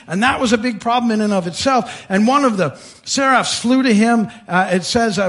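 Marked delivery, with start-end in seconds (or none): none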